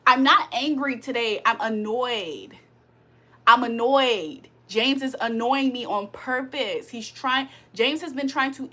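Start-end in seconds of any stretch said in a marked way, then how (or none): 1.5-4.5 each word stretched slowly, heavily; slow, drawn out. Shown as a demonstration. Explaining to the stream how annoyed sounds, slow and drawn. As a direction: spell out annoyed slowly, like a lesson. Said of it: "let me explain it to you".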